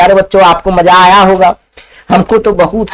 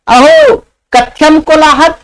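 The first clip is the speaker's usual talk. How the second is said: lazy man's line; 7 semitones higher than usual. Acted. First lazy man speaks Sanskrit.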